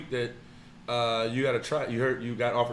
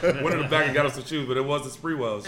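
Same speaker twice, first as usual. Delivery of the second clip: ordinary delivery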